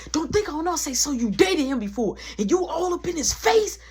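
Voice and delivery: high, heated accusation; retold yelling. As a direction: act out going off on someone. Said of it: "I'm yelling, I'm going off".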